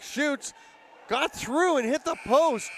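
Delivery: high, loud call of a near goal; excited play-by-play. Real, not acted. Commentator calling a shot off the post, excited. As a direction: burst into excited live commentary as the shot goes.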